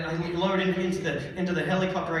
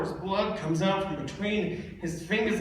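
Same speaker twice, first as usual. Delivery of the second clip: ordinary talk, nothing marked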